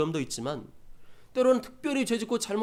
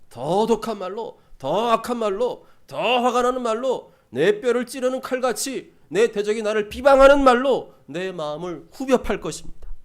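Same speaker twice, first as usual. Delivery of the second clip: forceful preaching; 5 semitones higher, 8 dB louder than usual. Real, not acted. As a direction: drive each phrase harder, drawing out the opening. Preacher building list of harsher words.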